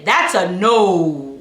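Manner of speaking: a stern final no, slowly. Shown as a demonstration. Mom showing her stern no to her child, slow firm voice. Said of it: "change the tone of my voice and say".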